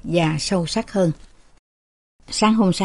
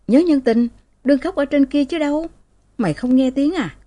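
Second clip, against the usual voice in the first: sharp-tongued; 7 semitones higher than usual. Acted. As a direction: spit it out like a scold. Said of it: "giọng đanh đá". (giọng đanh đá chua ngoa)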